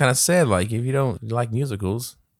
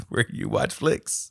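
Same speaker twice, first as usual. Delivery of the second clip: laughing; much slower than usual. Real, not acted. Fumbles tagline while laughing.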